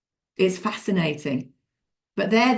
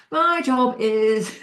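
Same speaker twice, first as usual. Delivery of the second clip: drawn-out, higher-pitched mock improvising; making it up; 5 semitones higher, much slower than usual. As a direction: stretch it out as if inventing an answer. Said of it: "They make it up on the spot".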